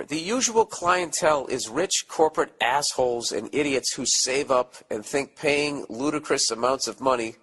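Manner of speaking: ordinary talk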